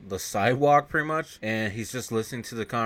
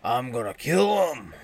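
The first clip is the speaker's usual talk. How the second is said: slow, drawn-out villain threat; mock menacing threat; 3 semitones higher, 4 dB louder, slower than usual. Junkyard boss vowing revenge, slow mock menace. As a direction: stretch it out like a movie heavy.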